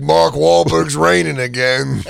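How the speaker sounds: slow, drawn-out put-on resident voice; tent-city guy. Tent city guy watches golf balls fall, mock resigned. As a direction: draw it out like a resigned local character. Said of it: "Guy goes".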